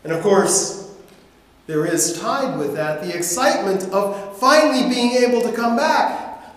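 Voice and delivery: ordinary talk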